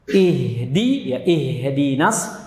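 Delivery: "ihdinas" slowly, H streaming into "di"; drawn-out "ihdi", then "ihdinas", airy H; flowing H. Shown as a demonstration. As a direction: let air run on through "ih" before "di". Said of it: "haknya dialirkan terus suaranya".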